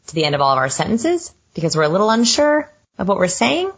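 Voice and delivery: unsure upspeak, rising on SENTENCES and SAYING; question inflection. Shown as a demonstration. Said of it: "that's what it sounds like".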